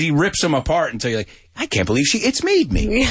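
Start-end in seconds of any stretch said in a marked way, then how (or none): 1.6-3.1 high-pitched retold disbelief; mock indignation. Seinfeld picking apart a little thing, mock-indignant and high. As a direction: imitate someone obsessing over a petty slight, pitched up. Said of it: "And then he got upset".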